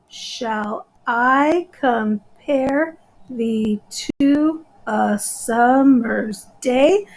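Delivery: very slow, each syllable set apart; slow, deliberate syllable-counting delivery. Shown as a demonstration. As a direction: break the line into countable syllables. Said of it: "And I count them".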